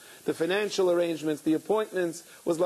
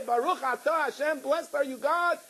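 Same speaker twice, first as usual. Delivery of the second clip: 'Baruch atah Hashem' much higher, rising and fervent; fervent blessing; 9 semitones higher than usual. Retold or imitated. Father overjoyed at speaking to God, much higher fervent blessing. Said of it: "make the bracha".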